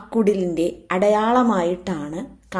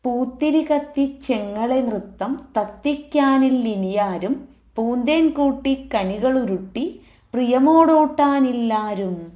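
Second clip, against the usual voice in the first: higher, lilting metrical verse; poem recitation; 4 semitones higher than usual. Acted. Teacher reciting the lament for the lost mango tree, poem recitation. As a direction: recite in metre at a raised pitch.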